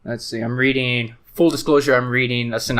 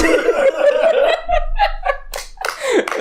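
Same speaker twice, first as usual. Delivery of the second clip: high laugh; 4 dB louder than usual. Real, not acted. Laughing at own impression.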